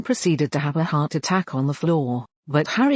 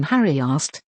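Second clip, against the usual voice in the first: usual speaking voice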